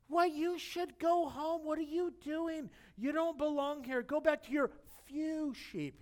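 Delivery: high, sneering big-brother taunt; high, scornful put-down; mocking older brother. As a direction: belittle him as the pipsqueak. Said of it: "the ridicule, the sarcasm".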